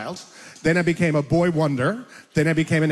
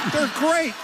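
higher-pitched mock stage rant; heated rant; 9 semitones higher than usual. Comedian ranting about fighter jets over a robocall, heated rant.

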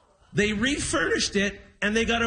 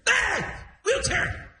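high-pitched indignant squawk; mock outrage; 12 semitones higher, slower than usual. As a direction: imitate an offended dad, voice shooting up. Dad offended by wheelchair suggestion.

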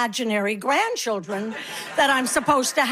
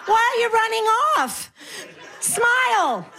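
higher and higher, faster, cheeky; pretend deaf lady, rising; 7 semitones higher than usual. Comedian mocking hecklers, high playful voice. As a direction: push the mishearing into squeaky glee. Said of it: "I'll do what I do".

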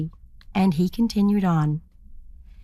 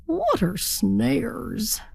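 wondering aloud; 3 semitones higher, much slower than usual. A narrator's reading. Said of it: "he wondered to himself".

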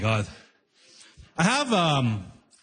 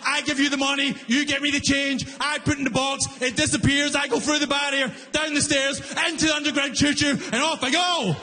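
high, fast exasperated rant; losing it; 5 semitones higher than usual. Retold or imitated. Comic to booth worker, ranting fast and high. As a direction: lecture him, getting more worked up. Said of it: "I lost it".

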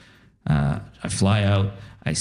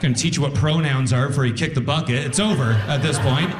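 laughing retort; 6 semitones higher than usual. Retold or imitated. Grandson laughing retort to grandma.

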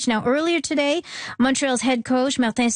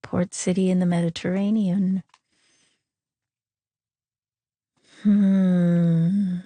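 low, pondering repeat of a clue; mulling it over; 4 semitones lower, slower than usual. Real, not acted. Contestant puzzling over the word game clue, low and musing. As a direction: think aloud, pitch dropped. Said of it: "she's mulling it over".

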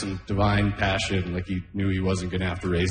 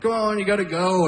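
nagging dad; 11 semitones higher than usual. Retold or imitated. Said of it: "Joseph would be like".

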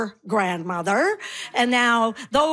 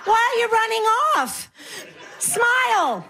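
much higher put-on granny call; calling after someone; 10 semitones higher than usual. Deaf granny to someone running off, mock calling out. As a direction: call out in a high mock-innocent voice.

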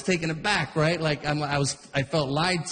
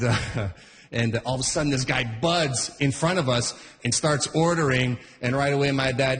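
usual speaking voice

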